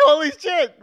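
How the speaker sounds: high, loud burst of awe; retold amazement. Retelling watching the bus jump, loud amazed outburst. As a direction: relive the thrill at full volume. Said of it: "I was like".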